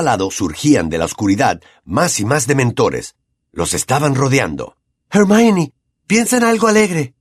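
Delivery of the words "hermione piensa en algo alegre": high, loud urging shout; shouted plea. A narrator's reading. Harry shouting to Hermione among dementors, urgent. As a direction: cry out to her for help. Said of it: "gritó Harry".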